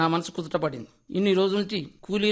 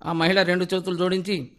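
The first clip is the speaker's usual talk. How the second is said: plain reading voice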